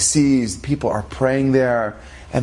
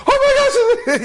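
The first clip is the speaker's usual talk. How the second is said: very high hammed-up scream; mock shocked shriek; 4 dB louder, slower than usual. Wife seeing her supposedly dead husband walk in, mock shriek. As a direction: squeal it in comic shock.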